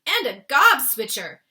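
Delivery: loud, booming BFG voice; the giant scolding the dream in the jar; crying giant voice. Acted. The BFG shouts at the trapped dream, loud and scolding. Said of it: "he cried".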